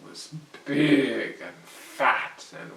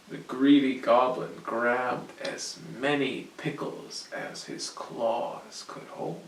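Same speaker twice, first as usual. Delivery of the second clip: plain reading voice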